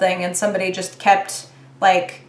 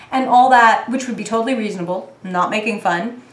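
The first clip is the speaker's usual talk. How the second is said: ordinary talk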